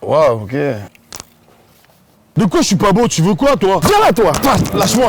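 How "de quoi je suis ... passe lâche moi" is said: bagarreur énervé. Acted. Picking a fight.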